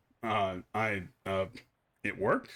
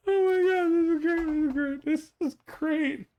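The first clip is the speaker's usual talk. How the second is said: high loud squeal easing down; thrilled; 4 dB louder than usual. Real, not acted. Player thrilled with the hat, squealing then calming. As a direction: gush with glee, settling by the end.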